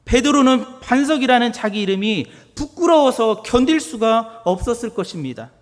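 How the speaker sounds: loud, high-pitched preaching; fiery pulpit delivery; preaching at full force. Acted. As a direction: proclaim it to the congregation.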